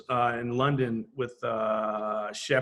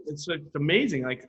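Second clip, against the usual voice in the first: ordinary talk, nothing marked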